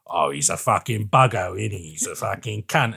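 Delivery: low, soft Aussie robot imitation; a hint of Hugo Weaving's Australian twang; Australian accent. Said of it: "you're not Australian".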